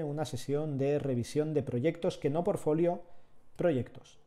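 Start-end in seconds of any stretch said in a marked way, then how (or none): none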